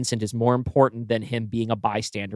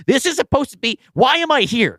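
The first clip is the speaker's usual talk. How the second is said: high, louder, bewildered rookie guard; flustered Liam; 11 semitones higher, 4 dB louder than usual. Retold or imitated. Rookie guard outdone by Stephanie, high flustered whine. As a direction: play him lost and overwhelmed. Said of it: "and he is like".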